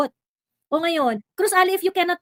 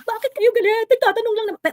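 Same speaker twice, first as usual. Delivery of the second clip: mocking quote; 8 semitones higher than usual. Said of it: "Sila magtatanong sila".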